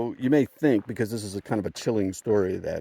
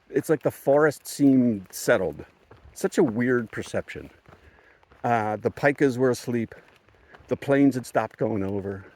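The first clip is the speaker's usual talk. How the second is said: ordinary talk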